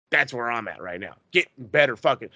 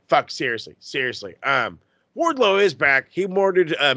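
ordinary talk, nothing marked

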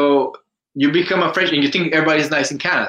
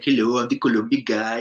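put-on Colombian accent. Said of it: "I raise my accent".

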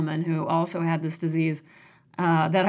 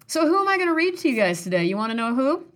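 excited teasing; 6 semitones higher, faster than usual. Real, not acted. Teasing the reveal.

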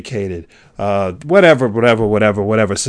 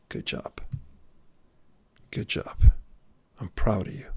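very soft, tender whisper of approval; whispered praise; 4 semitones lower, 10 dB quieter than usual. Pretend. Speaker's voice in the listener's head, tender whisper. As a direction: whisper it close, like a proud parent.

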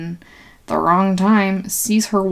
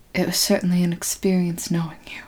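quick, softer breathy aside; sarcastic whisper; 5 dB quieter, faster than usual. Retold or imitated. Mama sarcastically whispering goodbye to Mister Jones. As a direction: murmur a dry farewell.

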